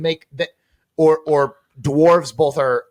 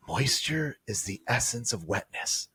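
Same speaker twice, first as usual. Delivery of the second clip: slow, soft, hushed ad-voice parody; breathy commercial murmur, dragged out; quoting the TV ad; 5 semitones lower, 13 dB quieter than usual. Retold or imitated. Host quoting the cologne ad to friends, softly and slowly. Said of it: "the commercial comes on the TV".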